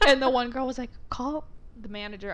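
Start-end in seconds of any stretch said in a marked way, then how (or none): 1.1-2.3 softly voiced coworker; hushed aside. Coworkers conferring out of earshot.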